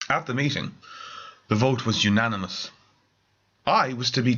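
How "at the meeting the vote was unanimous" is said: ordinary delivery, nothing marked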